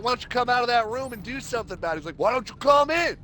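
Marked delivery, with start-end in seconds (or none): 0.0-2.0 high, fast taunting yell; hammed-up picking a fight; mock challenge. Taunting roommate through wall. 2.2-3.2 high yelled dare back; staged roommate yell; mock taunt.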